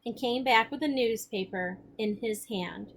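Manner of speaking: expressive read-aloud voice, the characters lightly acted; story narration. A narrator's reading. Teacher reading the novel to the class, expressive narration. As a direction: read the chapter aloud with feeling.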